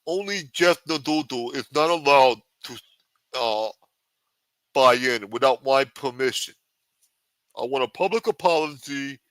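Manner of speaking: a hint of a wiseguy, boasting and threatening; half-hearted mafia don pitching his vodka; lightly voiced mob boss. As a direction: play a touchy mob boss selling vodka.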